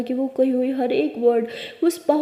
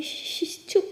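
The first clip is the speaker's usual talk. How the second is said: hushing whisper; 3 semitones higher than usual. Acted. Girlfriend hushing her sobbing partner, whispered shush. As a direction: whisper to hush her, gently but firmly. Ishani hushing crying Pari.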